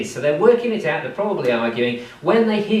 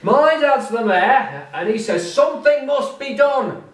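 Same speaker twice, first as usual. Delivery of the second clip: mocking the bragging lad; 8 semitones higher, 4 dB louder than usual. Friends mocking the mayor's son, high whiny mimicry. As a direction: parrot his boast in a whiny, higher voice. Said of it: "You tell us every evening".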